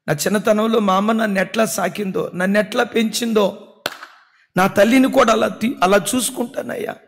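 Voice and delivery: impassioned tearful prayer for mother; tearful pleading prayer. Retold or imitated. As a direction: beg God through tears, voice lifted high. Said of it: "ఏడుస్తూ అడుగుతానన్నా".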